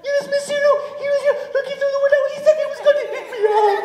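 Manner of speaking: very high, fast, breathless squeak; frightened sheep. Acted. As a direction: babble fast in a tiny high voice. Frightened sheep reports the wolf.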